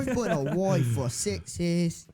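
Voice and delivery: hammed-up Aussie twang; high, slow Australian accent put on for a laugh; mock Australian accent. Trying on an accent.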